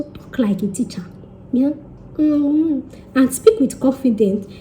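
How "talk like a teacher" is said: whispered aside; whispered advice to viewers. Real, not acted. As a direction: drop to a breathy whisper. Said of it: "unvoiced whisper".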